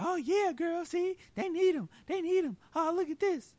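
very high excited gossip voice; squeaky mock-outraged agreement; mock gullible followers. Mimics gullible followers cheering her.

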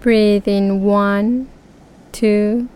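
unhurried counting with pauses; slow breath counting. Shown as a demonstration. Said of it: "We will start breathing to a count".

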